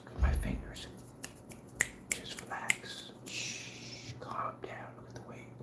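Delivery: soft unvoiced ASMR whisper. Mock ASMR whisper to calm down an angry critic. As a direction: whisper him to sleep like a relaxation video. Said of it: "should I put on some".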